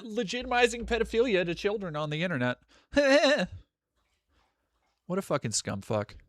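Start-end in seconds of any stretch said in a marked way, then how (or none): none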